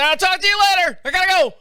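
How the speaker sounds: high, hurried and urgent. Retold or imitated. Husband to wife while rushing into the bathroom, high urgent blurt. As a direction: blurt it out, no time to talk.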